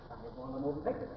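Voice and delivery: ordinary delivery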